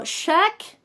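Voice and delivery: "shack" high, with a short frontal A; choni A. Shown as a demonstration. Teacher giving a contrast example, "shack" high with front A. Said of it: "por ejemplo".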